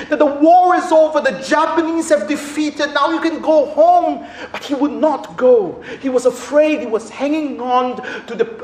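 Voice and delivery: high-pitched, rising preacher's fervour; fervent pulpit storytelling; full-force preaching. Acted.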